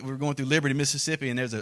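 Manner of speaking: ordinary delivery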